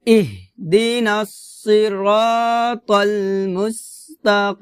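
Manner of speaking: "ইহদিনাস সিরাতাল মুস্তাকিম" with heavy sad and throaty qaf; tajweed demo of "ইহদিনাস সিরাতাল মুস্তাকিম", slow and sustained; slow Quran recitation. Shown as a demonstration. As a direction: stretch the long vowels of "সিরাতাল" and "মুস্তাকিম". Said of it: "আমার সাথে বলেন সম্পূর্ণ আয়াতটা".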